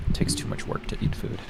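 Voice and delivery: breathless, airy near-whisper; puffing between bites; out of breath. Real, not acted. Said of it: "That's why I'm so out of breath".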